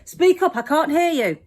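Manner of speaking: high, loud mock teacher telling off; bossy teacher. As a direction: call it out like a teacher. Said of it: "teachers will stop saying".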